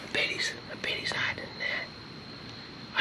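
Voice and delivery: hushed whisper, barely voiced; wildlife watching whisper. Real, not acted. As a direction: whisper so the spider won't bolt. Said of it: "I started whispering so I don't scare him".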